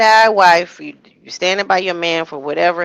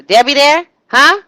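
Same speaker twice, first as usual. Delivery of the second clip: higher, louder nagging caller voice; mock his pushy check-in call; hammed-up pushy caller asking for Debbie; imitating him on the phone; 6 semitones higher, 7 dB louder than usual. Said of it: "Sound like he".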